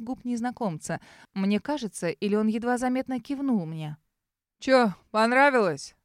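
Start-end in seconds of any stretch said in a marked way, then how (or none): 4.6-6.0 наглый, медленный вызов; lightly voiced наглый бандит; развязный гопник. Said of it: "голос Серого". (развязно, нагло)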